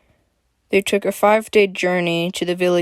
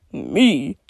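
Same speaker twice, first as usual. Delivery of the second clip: scoff; 3 semitones lower, 3 dB louder than usual. A narrator's reading. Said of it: "he scoffed".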